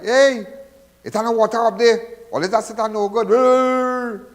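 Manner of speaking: loud, high, rough mock complaint; rude complaint. Shown as a demonstration. Host mocking how not to message the CEO, loud and crude. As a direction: bark it out like a rowdy complainer. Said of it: "not like".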